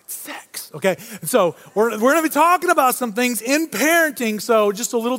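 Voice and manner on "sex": whispered word. Real, not acted. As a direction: whisper the word sex, voiceless. Saying a sensitive word to congregation.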